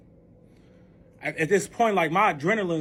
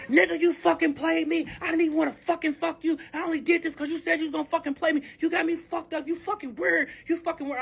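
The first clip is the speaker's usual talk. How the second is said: high-pitched furious rant, imitated; fly off the handle in a high, rapid tirade; acted-out angry outburst; blowing up; 8 semitones higher than usual. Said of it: "He bloops the fuck out".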